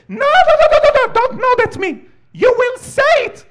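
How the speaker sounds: put-on shrill, very high, loud old woman's commanding shriek; terrifying old grandma. Grandma refuses nods, demands words.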